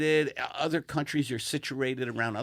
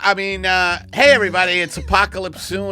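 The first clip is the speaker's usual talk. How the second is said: loud, higher, punchy host pitch; podcast ad read; 5 semitones higher, 8 dB louder than usual. Acted. Host pitching the sponsor's T-shirts to listeners, loud emphatic ad read. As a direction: sell it big to the listeners.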